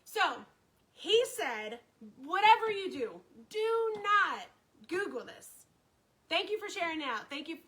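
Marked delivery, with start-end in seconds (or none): 2.2-5.5 loud, raised, spaced-out command, easing at the end; punched-up imitation of a doctor's warning; emphatic warning. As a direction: retell the doctor's firm warning. Doctor's stern warning acted out.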